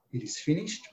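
weak breathy. Retold or imitated. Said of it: "no strength at all".

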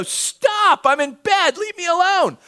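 high, loud mock yell from upstairs; hammed-up irritated shout; annoyed yell. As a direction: shout down as a sleepy grumpy neighbour. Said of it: "he yells down from the window".

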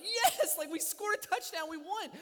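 loud, high-pitched fan celebration; exultant sports-fan shout; passionate cheer. Acted. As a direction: leap up yelling for the winning touchdown. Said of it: "Because I'm passionate about that".

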